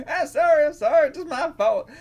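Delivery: high, loud hammed-up apology; apologetic character voice. Old beggar apologising to the others, high put-on voice.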